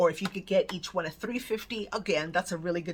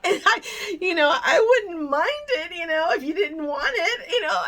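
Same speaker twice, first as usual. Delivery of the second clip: much higher and louder, laughing and coy; laughing ask; 9 semitones higher, 6 dB louder than usual. Retold or imitated. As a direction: giggle out a shy request. Politely angling for the candle.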